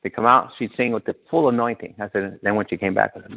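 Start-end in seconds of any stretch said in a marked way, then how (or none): none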